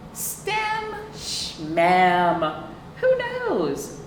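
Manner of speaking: usual speaking voice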